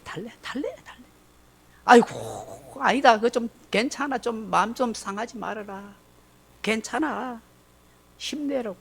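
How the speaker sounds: soft, gentle comforting words; soothing. Retold or imitated. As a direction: coax a hurt child softly. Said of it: "달래시는 하나님". (부드럽게 달래는 목소리)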